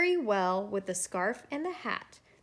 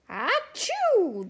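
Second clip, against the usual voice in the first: loud, high storybook "achoo"; gigantic acted sneeze; 9 semitones higher, 5 dB louder than usual. A narrator's reading. Snowman chilled after being rebuilt, gigantic sneeze. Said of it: "The snowman sneezed a gigantic".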